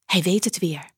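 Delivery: plain reading voice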